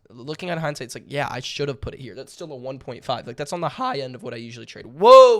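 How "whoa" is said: voice crack. Real, not acted. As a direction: voice cracking high and loud. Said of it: "voice crack".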